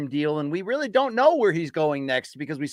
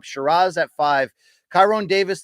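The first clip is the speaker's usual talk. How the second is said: normal talking voice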